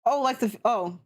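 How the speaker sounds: normal talking voice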